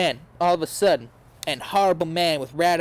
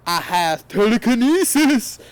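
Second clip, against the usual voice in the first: high, loud, menacing voice; higher, louder sneering dark lord; cruel villain voice; 7 semitones higher, 6 dB louder than usual. A narrator's reading. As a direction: taunt her cruelly. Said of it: "he answered cruelly".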